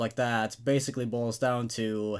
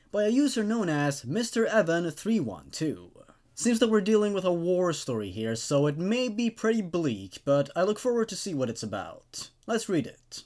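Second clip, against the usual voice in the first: ordinary talk, nothing marked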